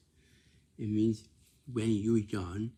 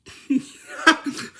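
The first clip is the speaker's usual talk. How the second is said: loud, high burst of laughter; sudden high-pitched laugh; real laughter; 12 dB louder than usual. Real, not acted. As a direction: crack up laughing. Laughs at faces made.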